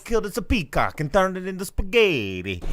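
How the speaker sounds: high, loud cartoon Italian father; hammed-up Italian-accented threat; mock Italian accent. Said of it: "he's like".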